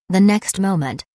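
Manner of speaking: ordinary delivery, nothing marked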